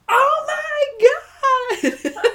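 high, loud, drawn-out squeal; excited shriek. Retold or imitated. Reader voicing the other raiders, a drawn-out excited squeal. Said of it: "other raiders being like".

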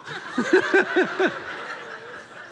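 wheezing giggle; high breathless laugh. Real, not acted. Pastor laughs at the jaw line, high wheezing giggle. Cracking up at the quote.

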